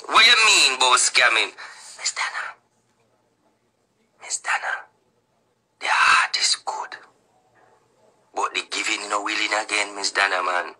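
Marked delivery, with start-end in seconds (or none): 2.0-7.0 hushed whisper. Real, not acted. Whispering a hushed plea to Miss Dana. 8.3-10.7 quiet. Real, not acted. Talking low and soft to Miss Dana.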